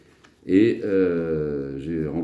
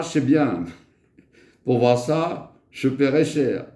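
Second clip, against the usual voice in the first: higher, playful poem recital; récitation de poème; 8 semitones higher, 3 dB louder than usual. Acted. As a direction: recite a tongue-twisting comic poem, pitched up. Récite son poème du chat. (récitation espiègle d'un poème, voix plus aiguë)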